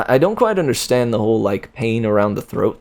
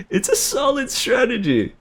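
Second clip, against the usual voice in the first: mock voice; 13 semitones higher than usual. Joking sphinx line.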